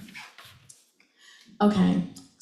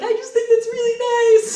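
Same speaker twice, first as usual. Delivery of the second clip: teary high whimper; 12 semitones higher, 5 dB louder than usual. Retold or imitated. Retold crying self quote.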